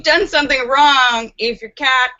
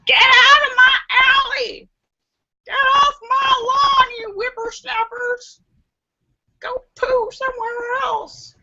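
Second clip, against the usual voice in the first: put-on grumpy old man yelling; 13 semitones higher than usual. Cranky old man shouting at alley intruders, high and cracking. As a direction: shake your fist and holler at the kids off your lawn.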